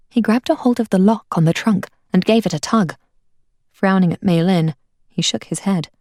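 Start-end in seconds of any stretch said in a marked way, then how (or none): none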